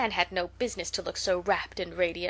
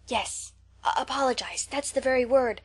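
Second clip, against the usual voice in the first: eager; 4 semitones higher than usual. A narrator's reading. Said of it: "said Matthew eagerly".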